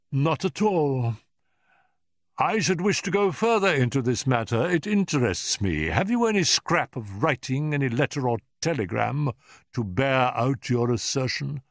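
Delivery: put-on high, emphatic old professor; imperious Professor. A narrator's reading. Professor demands written proof from Holmes, imperiously insistent. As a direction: press the visitor with haughty insistence.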